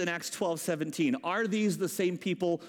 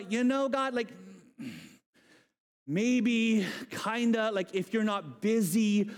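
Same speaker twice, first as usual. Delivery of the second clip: halting, apologetic mock request; tentative prayer. Timid believer asking God a favour, tentative. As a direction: ask hesitantly, drawing words out, afraid to impose.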